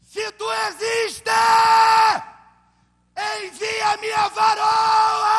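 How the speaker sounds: high, slow, drawn-out shout; desperate cry to God. Retold or imitated. Friend praying on the mountain for a wife, desperate shout. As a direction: bellow it up to heaven, begging.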